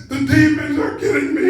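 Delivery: very high, loud mock-terrified shriek; retold frantic wail. Daughter telling her mother about demons, retold frantic wail. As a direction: impersonate a panicked daughter, hammed-up.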